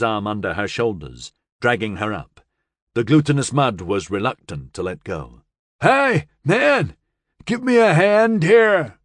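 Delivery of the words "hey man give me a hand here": high, loud call for help; desperate yell across the mud. A narrator's reading. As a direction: shout to the land ahead, slow and strained. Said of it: "he yelled at the land ahead".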